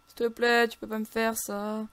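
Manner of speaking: desperate whining plea; pleading wail. Real, not acted. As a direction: beg with stretched vowels. Begging the game not to kill.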